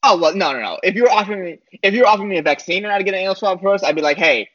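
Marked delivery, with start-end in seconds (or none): none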